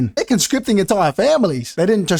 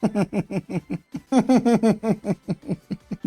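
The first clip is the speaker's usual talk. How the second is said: long, quiet, stuttering cackle; drawn-out staccato laugh; gloating laugh; 3 semitones higher, 4 dB quieter than usual. Acted. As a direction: laugh at the enemy's defeat. Mocks the defeated Han army.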